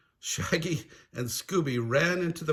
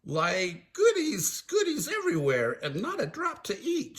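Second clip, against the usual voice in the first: Shaggy complaining; 9 semitones higher than usual. Acted. Shaggy moaning to Scooby about untouchable goodies, high complaint. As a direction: complain in a high-pitched goofy voice. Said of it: "Shaggy complained".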